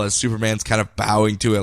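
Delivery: ordinary talk, nothing marked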